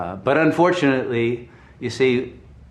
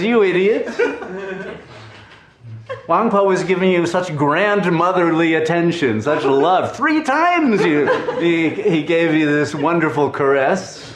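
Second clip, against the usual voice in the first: higher, louder mock scolding, half laughing; laughing teacher telling off his student, higher and louder; 7 semitones higher, 4 dB louder than usual. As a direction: berate the foolish student, hammed up and amused. Dayu scolds the monk.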